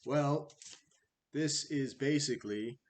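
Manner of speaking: ordinary delivery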